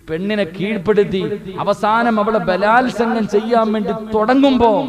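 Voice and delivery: high, loud, mounting sermon narration; impassioned preacher's storytelling; full-force preaching. Real, not acted. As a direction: build the story loudly to a cliffhanger.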